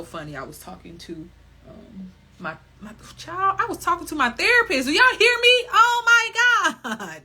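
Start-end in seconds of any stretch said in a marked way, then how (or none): 2.8-7.2 suddenly high and loud, almost shrieking; excited outburst. Real, not acted. Catching herself mid-sentence to the crowd, excited shriek. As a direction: burst out loud at her own slip.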